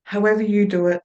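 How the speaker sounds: measured hypnotist's patter with long pauses between phrases; hypnotic trance induction. Shown as a demonstration. Trainer demonstrates Milton Erickson's trance patter to students, hypnotic and unhurried.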